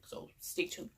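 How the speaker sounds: teasing whispered sign-off. Real, not acted. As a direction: whisper it like a secret. Teasing the next part.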